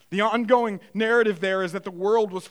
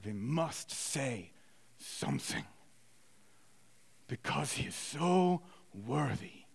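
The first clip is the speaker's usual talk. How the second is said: hushed, slow German professor; low German-accented lecturer, weighing each word; 7 semitones lower, 11 dB quieter, slower than usual. Retold or imitated. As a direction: speak softly and reverently, German accent. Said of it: "in German accent, I'm gonna do my best".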